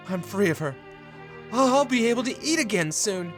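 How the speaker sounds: high-pitched rush of relief; elated relief. Acted. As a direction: rejoice at being free, pitch climbing. Husband relieved she is gone.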